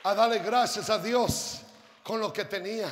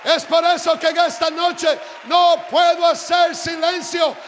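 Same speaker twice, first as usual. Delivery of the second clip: loud, high, shouted exhortation; full-force preaching; 8 semitones higher, 12 dB louder than usual. Real, not acted. Preacher urging the congregation to praise God, shouted preaching.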